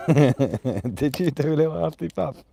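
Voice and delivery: slow, laughing through the words; affectionately laughing at the dog's huff; laughing tease. Real, not acted. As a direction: giggle it at the dog, drawn out. Repeats it laughing.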